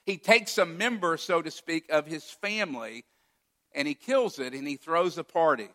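ordinary talk, nothing marked

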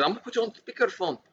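put-on high-pitched caricatured Minnesotan accent; mock Minnesotan accent. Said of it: "turned her into a Vietnamese Minnesotian".

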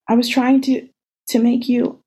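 high, broken tearful words. Acted. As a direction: choke it out through tears. Sobbing explanation.

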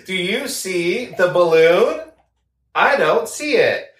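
Dora the Explorer. Acted. Co-host joining the bit, mock Dora voice. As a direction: coax imaginary little viewers, spacing every phrase. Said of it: "It's like Dora the Explorer".

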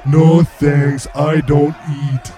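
slow, loud-starting deadpan oaf; simpleton hero. Acted. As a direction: decline politely in a big dumb voice. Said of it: "Toot's gonna be like".

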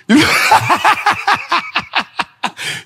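real high-pitched cackle; laughing at the joke; high laughing fit. Real, not acted. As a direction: break up laughing. Laughing at own [name] bit.

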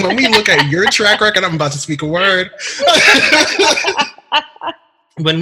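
high laughing through the words. Real, not acted. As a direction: talk while cracking up. Laughing while starting the praise.

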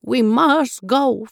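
drawn-out storybook resolve; firm insistence. A narrator's reading. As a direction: insist slowly as the grandmother goat. Grandmother goat insists they leave.

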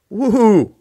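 high, loud whooping woohoo; mock-shocked whoop at a hard verse. Real, not acted. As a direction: let out a big playful whoop. Reacting to the verse.

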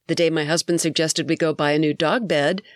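ordinary talk

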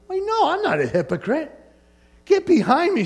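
high-pitched indignant refusal; imitating a startled rebuttal; acted protest. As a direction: jump up in pitch to push back at an accuser. Acting out rebuking the devil.

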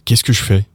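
"Qu'est-ce que je fais" as "kess-kush-fay"; quick contraction. Shown as a demonstration. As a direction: reduce "je" to "sh". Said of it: "when you say it quickly".

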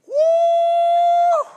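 high, loud laughing whoop; excited whoop. Retold or imitated. Preacher whooping as a dad running to his boys. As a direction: let out a joyful woo.

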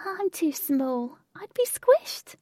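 high, slow little voice; small character's voice. Acted. Jane explains to Lynx why she stayed out, small high voice.

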